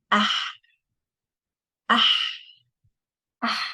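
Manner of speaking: ah with a breath of ha after it; alif before the ha. Shown as a demonstration. Teacher drilling a hard Arabic letter, alif before the ha. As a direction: say "ah", ending on the Arabic ha. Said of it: "put the alif before it".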